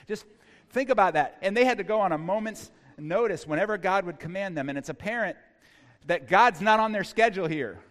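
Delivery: loud, driving sermon voice; preaching at full force. Acted. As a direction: press the point home to the congregation.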